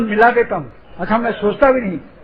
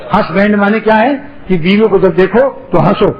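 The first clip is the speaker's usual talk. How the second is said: ordinary talk, nothing marked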